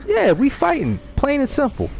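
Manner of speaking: high-pitched, flat-out insistent; heated declaration. Real, not acted. Insisting to a friend he'd fight the man, heated. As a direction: say it like it's final.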